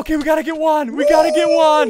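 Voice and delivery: high and loud, building in force; rally the team at full volume; excited rallying shout. Real, not acted. Rallying team to hunt target.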